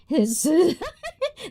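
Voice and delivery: a light laugh mid-question; teasing laugh. Real, not acted. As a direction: laugh while teasing the viewer. Teasing chat about two voices.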